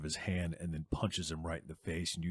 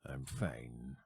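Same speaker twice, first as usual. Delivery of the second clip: much lower and quieter; low curt reply; 6 semitones lower, 9 dB quieter than usual. Acted. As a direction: shut the question down quietly. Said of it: "he just goes".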